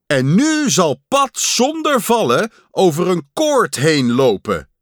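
high, loud stage announcer; grand showman's proclamation. A narrator's reading. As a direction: announce a daring act to the hall. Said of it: "zei de stem".

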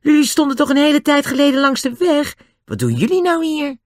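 high-pitched, surprised calling; shouting back. A narrator's reading. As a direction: call out questions in surprise. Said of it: "Toen schreeuwde hij terug".

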